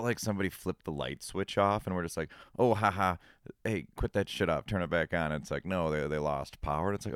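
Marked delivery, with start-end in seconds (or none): none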